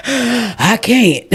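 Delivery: gasping laugh. Retold or imitated. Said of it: "the more I kept saying".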